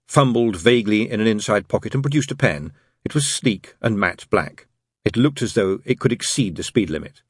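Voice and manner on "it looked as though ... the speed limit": ordinary delivery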